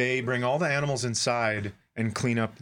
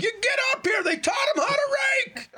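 screaming. Retold or imitated. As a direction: scream it to everyone. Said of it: "I scream to everyone else".

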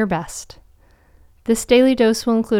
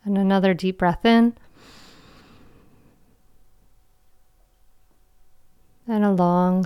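guided meditation. Acted. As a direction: guide them gently into calm.

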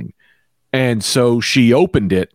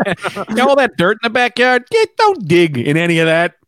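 higher-pitched mock cop warning; cop impression; 7 semitones higher than usual. Cop warns about the backyard, mock warning. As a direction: warn them off like a cagey cop.

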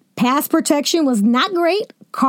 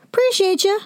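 higher repeat; 6 semitones higher than usual. Retold or imitated. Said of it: "And now he's saying".

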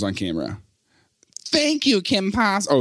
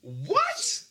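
pitched way up, squeaky; high squeaky voice; 6 dB quieter than usual. Real, not acted. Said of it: "My voice is so high".

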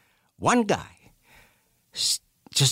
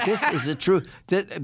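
usual speaking voice

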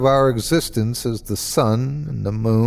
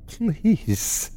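high, soft whimpered plea; pained whimper; 7 semitones higher, 5 dB quieter than usual. Acted. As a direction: whimper it through the pain. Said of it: "whimpering with the pain in my hand".

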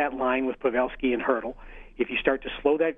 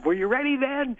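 mocking yell pitched up high; retold yell; 12 semitones higher than usual. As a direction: taunt the ref, voice jumping up. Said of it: "I yelled at him".

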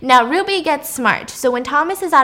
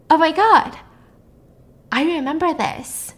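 higher-pitched, acted astonishment; emotional realisation; 6 semitones higher than usual. Podcaster acting captive recognising a childhood book, astonished. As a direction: let a memory hit you, pitch lifting. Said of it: "we see a lot of emotion".

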